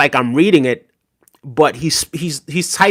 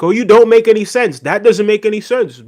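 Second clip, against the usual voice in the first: mocking critics; 7 semitones higher, 3 dB louder than usual. Mocking critics' dismissive replies.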